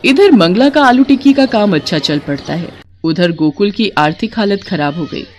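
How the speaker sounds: ordinary delivery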